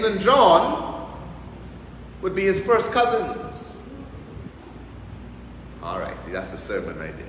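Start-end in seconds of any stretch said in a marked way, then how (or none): 5.8-7.2 quiet aside. Real, not acted. Aside to the congregation.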